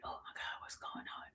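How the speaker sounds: very soft, worried whisper. Retold or imitated. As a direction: lean in and whisper the alarm. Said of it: "we whispering".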